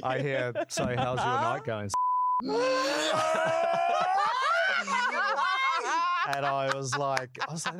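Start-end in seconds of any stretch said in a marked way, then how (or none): 0.7-1.9 high, breathy come-on; sexy sultry voice. Retold or imitated. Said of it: "in this sexy, sultry voice". 1.9-6.3 big shrieking laugh; loud laugh. Real, not acted. Laughs after the sultry voice.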